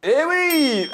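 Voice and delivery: high, loud burst of delight; excited cheer. Real, not acted. Quiz host cheering Louis's winning answer, excited shout. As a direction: shout it out like the winner just scored.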